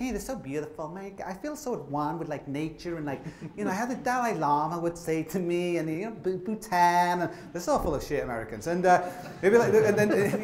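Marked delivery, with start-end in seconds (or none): 0.0-7.2 mock the spiritual tourist gently.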